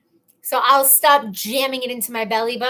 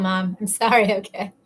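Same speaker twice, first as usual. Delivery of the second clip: normal talking voice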